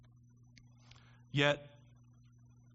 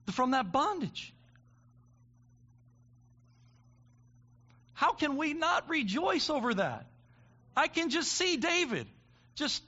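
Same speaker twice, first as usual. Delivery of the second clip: loud, high impassioned preaching climax; preaching at full force; 7 semitones higher, 5 dB louder than usual. Real, not acted. Preacher rousing the congregation about the Savior, full-force preaching. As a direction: build it to a shouting crescendo.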